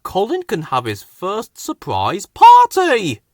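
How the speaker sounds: thrilled with his plan; excited idea. A narrator's reading. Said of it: "Freddy thought".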